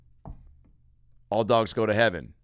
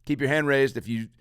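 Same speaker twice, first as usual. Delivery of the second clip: normal talking voice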